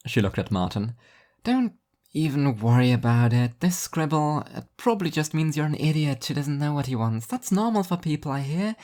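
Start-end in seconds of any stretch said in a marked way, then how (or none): 1.4-8.8 higher, breezy put-down; lightly voiced condescending devil. Devil dismissing Martin's scribble, higher and condescending. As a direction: pat him on the head with words.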